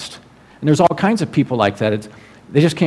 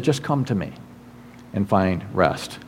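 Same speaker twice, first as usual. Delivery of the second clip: soft, sinking, tender reassurance; tender invitation; 6 semitones lower, 9 dB quieter than usual. Acted. Jesus to the downtrodden and anxious, tender invitation.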